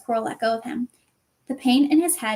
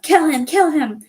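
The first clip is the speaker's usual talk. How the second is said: higher loud yelled order; yells; 4 semitones higher, 6 dB louder than usual. Acted. Voldemort yelling at Quirrell, loud. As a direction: yell it twice. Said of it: "Voldemort's yells of".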